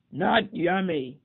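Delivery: slow loud bellow; slow booming bear bellow. Acted. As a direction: bellow it in disgust. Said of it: "he bellowed".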